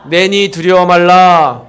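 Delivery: congregation call. Shown as a demonstration. Pastor leads congregation in repeating the verse, loud and slow. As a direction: lead the crowd in a loud slow recitation. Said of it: "한 번 더요".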